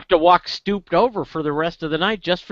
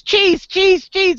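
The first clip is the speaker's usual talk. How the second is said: very high, loud duck chant; clamoring little duck; 14 semitones higher, 5 dB louder, much slower than usual. Acted. Duck pestering the bartender for cheese, high insistent cries. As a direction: squawk the demand over and over, high-pitched. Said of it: "And he goes".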